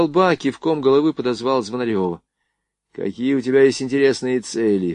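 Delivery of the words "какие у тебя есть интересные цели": usual speaking voice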